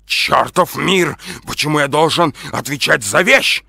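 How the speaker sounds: loud, high, indignant outburst; enraged shouting. A narrator's reading. Эдуард protests answering for a thing, shouting enraged. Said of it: "закричал взбешённый Эдуард".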